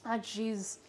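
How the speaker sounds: ordinary talk, nothing marked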